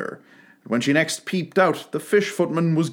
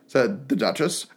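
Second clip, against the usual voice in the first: ordinary delivery, nothing marked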